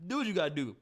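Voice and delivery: usual speaking voice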